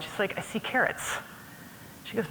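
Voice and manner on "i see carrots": annoyed. Retold or imitated. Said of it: "she's a little annoyed".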